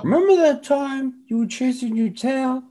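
high put-on dog falsetto; chatty dog voice. Dog calls to another at night, high mock voice. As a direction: reminisce as a squeaky cartoon dog.

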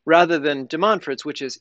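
ordinary delivery

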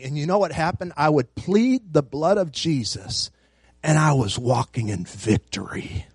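ordinary talk

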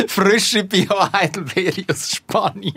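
high, slow, giggling confession; laughing. Real, not acted. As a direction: laugh it out, caught in a fib. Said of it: "Ich habe jetzt einfach mal hergelogen".

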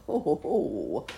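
loud, slow jolly ho ho ho; jolly roar. Acted. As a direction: boom it out like a jolly polar bear. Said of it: "roared the Whitewash in his jolly voice".

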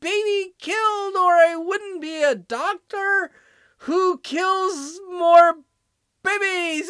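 high, loud sneering parody of a boastful doctor; hammed-up mocking quote; mock provider voice. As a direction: mimic her like a cartoon villain bragging. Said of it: "And you've admitted it".

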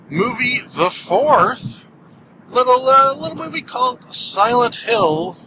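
high dramatic title announcement; trailer announcer. Pretend.

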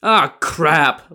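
high-pitched groan of dismay; moaning. A narrator's reading. Li Kui learns of his mistake, moaning in dismay. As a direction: moan it as the bad news lands. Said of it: "Li Kui moaned".

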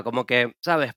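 normal talking voice

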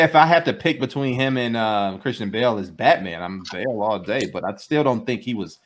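normal talking voice

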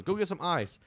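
mock urgent instruction; imitated order. Same character ordering people away.

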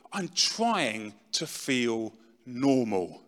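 drawn-out, heavily slowed reply; slow weary quote. Retold or imitated. Addict answering a worried friend, slow and drawn-out. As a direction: stretch every word like someone exhausted. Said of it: "And their response to you would be".

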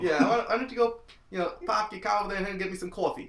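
loud, high mock Brooklyn tough; Brooklyn accent. Friend answers in a brash Brooklyn accent. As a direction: boss a buddy around, New York style. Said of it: "sinking into the Brooklyn one".

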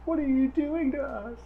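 high panicked whine; freaked-out players. Retold or imitated. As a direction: squeal it in mock panic. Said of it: "looking back at me like".

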